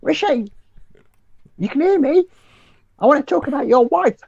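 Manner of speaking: high, calling-out mock voice; Priti Patel impression. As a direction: shout for "Rishi" in a shrill caricature. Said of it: "Does she speak like that?".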